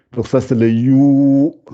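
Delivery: You stretched long and slow, rising; slow drawn-out You. Shown as a demonstration. Teacher to pupils, slowly drawing out You. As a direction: drawl the syllable You for the students. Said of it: "ça fait You".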